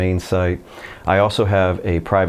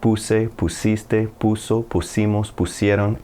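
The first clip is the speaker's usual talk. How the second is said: slow drill recitation; slower than usual. Shown as a demonstration. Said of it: "And then you say it again".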